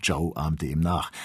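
ordinary delivery, nothing marked

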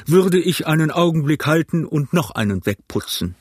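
daring captain's remark; ship captain. A narrator's reading. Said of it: "sagte der Kapitän".

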